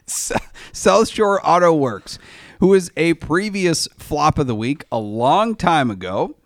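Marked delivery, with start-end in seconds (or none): none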